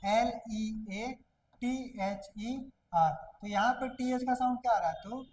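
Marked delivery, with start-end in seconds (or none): 0.1-3.1 letters spelt out one by one, very slowly; slow letter-by-letter spelling. Shown as a demonstration. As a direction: spell each letter with a pause between. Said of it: "इसी प्रकार से".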